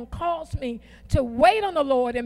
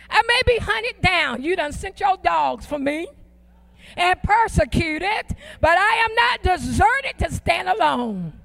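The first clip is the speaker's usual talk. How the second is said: high, loud preaching with defiant attitude, slowing into drawn-out words; forceful declaration; 3 semitones higher, 4 dB louder, slower than usual. Shown as a demonstration. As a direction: declare it at full force, stretching the key words. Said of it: "I'm declaring it".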